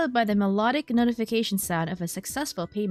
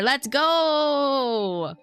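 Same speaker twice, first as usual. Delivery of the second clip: raised, drawn-out "go"; excited cheer; 6 semitones higher, 4 dB louder than usual. Real, not acted. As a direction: hype cry stretched on the last word. Cheering on own game character.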